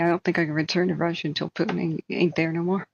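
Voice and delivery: ordinary talk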